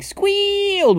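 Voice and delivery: SQUEALED stretched high and loud; squealed word. A narrator's reading. Narrator mimics the squeal in word.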